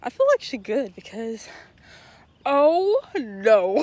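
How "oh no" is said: surprised oh no. Real, not acted.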